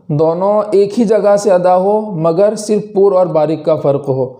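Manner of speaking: ordinary delivery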